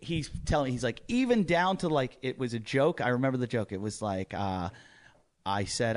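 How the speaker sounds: ordinary delivery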